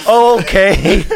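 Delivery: loud high laughing surprise; loud high laughing "oh, okay"; surprised. Retold or imitated. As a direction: burst out in startled realisation. Doctor's surprised reply, laughing.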